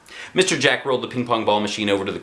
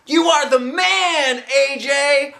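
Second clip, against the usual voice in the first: very high, louder, slow shout; shouted praise; 4 dB louder, much slower than usual. A narrator's reading. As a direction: hail the winner. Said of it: "Ryan shouted".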